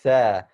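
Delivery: tha with the tongue out between the teeth, loud. Shown as a demonstration. Teacher reviewing tha, tongue between teeth. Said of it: "making sure your tongue comes out".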